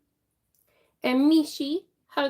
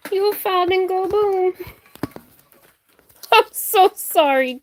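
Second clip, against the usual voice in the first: loud flustered apology; 5 semitones higher, 8 dB louder than usual. Real, not acted. Apologising loudly to startled viewers.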